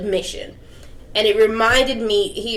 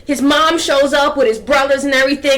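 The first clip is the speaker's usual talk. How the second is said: ordinary delivery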